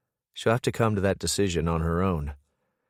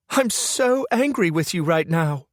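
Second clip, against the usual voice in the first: angry female voice; 10 semitones higher, 4 dB louder than usual. A narrator's reading. Brooke furious with Carter.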